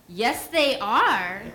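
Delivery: grandma's answer. Retold or imitated. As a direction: lay it down slowly like a sure grandma. Grandma's firm reply, retold.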